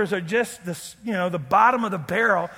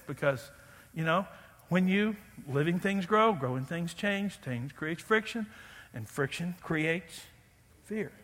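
hushed aside; 3 semitones lower, 8 dB quieter than usual. Acted. Quiet aside, soft repeat.

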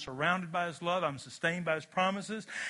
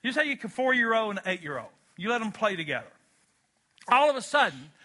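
ordinary talk, nothing marked

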